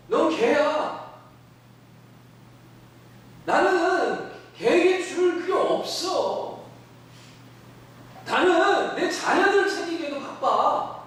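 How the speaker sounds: slow, high and bluntly dismissive, with a laugh at the end; drawn out and high, brushing off an outsider; Jesus dismissing the woman. Acted. Pastor voices Jesus bluntly brushing off the foreign woman, slow and high. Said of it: "이제는 아예 대놓고".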